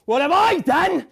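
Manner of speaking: high, loud cry of "What have I done" with stress on I; screamed horror. Acted. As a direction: scream it out in despair. Horrified self-questioning, screamed.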